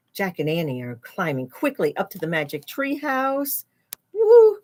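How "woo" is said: high, loud woo of relief; high cheer. Real, not acted. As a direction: cheer as they get away. Characters escape to treehouse.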